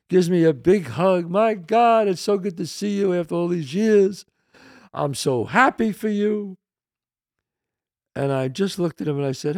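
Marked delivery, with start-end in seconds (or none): 1.3-6.6 higher-pitched gushing welcome; smiling, delighted greeting. Retold or imitated. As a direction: imitate an effusive old acquaintance. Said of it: "he smiles".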